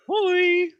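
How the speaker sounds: a loud, high Swedish Hoi; jokey Swedish greeting; Swedish accent. Retold or imitated. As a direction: greet him in an over-the-top Swedish accent. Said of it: "like, the most Swedish accent ever".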